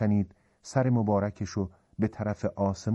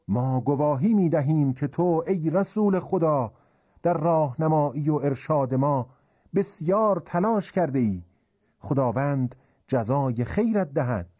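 raised, higher voice, emphatic testimony; crowd declaring in unison; 5 semitones higher, 4 dB louder than usual. Acted. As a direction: proclaim it loudly as one assembled crowd. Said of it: "یه صدا گفتن".

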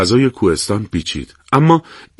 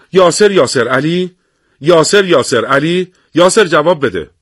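calling over radio; 6 semitones higher, 4 dB louder than usual. Acted. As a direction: call out the name across the line. (صدا زدن بلند پشت بیسیم)

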